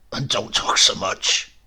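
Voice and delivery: a lightly voiced sick old uncle; grumbling old man. A narrator's reading. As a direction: mutter it like a cranky invalid.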